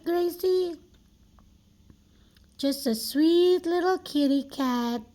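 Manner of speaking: usual speaking voice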